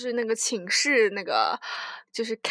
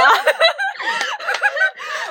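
尖声大笑; 5 dB louder than usual. Real, not acted. Laughs at own joke.

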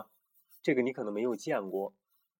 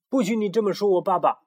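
higher, louder furious protest; angry outburst; 9 semitones higher, 6 dB louder than usual. Acted. Camelido shouts at the bully who insulted his father, furious. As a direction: defend your father hotly. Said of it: "愤怒地扑向了小胖墩儿".

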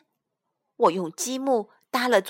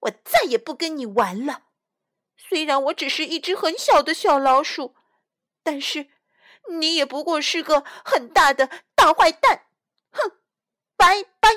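higher, louder indignant tirade building to a shrieked bye; angry yelling; 6 semitones higher, 4 dB louder than usual. A narrator's reading. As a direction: shout it all out, furious and hurt. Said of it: "我气得大叫起来".

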